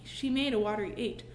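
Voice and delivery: ordinary delivery, nothing marked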